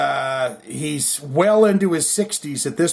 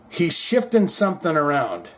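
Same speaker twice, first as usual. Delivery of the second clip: usual speaking voice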